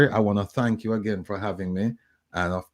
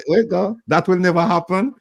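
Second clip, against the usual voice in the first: mock quote; 9 semitones higher, 6 dB louder than usual. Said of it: "I said".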